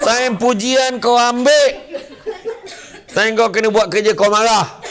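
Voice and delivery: high, loud mocking rebuke; scolding. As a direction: throw it back at her. Datuk menegur dengan kuat. (Datuk menyindir pekerja dengan kuat)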